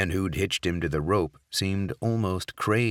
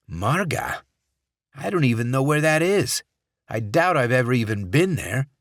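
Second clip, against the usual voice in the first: indignant senator; 7 semitones higher, 4 dB louder than usual. Acted. Senator denies.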